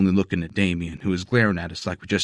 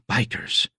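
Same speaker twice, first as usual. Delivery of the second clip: breathed out in a whisper; dramatic breath; 4 semitones higher, 3 dB quieter than usual. A narrator's reading. Gang boss sighing the word, breathed out dramatically. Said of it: "he dramatically breathed out".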